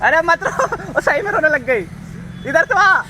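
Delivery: high, loud plea not to cry; loud pleading call; loud consoling. Real, not acted. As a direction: call her over, urging her to stop crying. Consoling crying girlfriend loudly.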